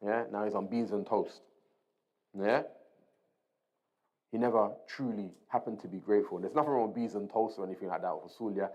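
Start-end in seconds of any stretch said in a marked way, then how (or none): none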